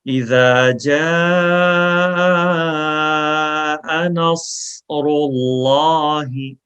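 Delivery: slow, melodic tajweed chant; Quran recitation. Shown as a demonstration. Teacher reciting for students to repeat after, slow melodic chant. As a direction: recite it slowly for students to echo.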